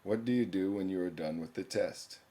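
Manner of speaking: ordinary talk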